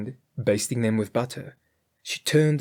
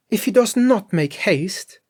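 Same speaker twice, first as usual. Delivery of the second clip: Grethel crying out; 9 semitones higher, 5 dB louder than usual. A narrator's reading. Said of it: "cried Grethel".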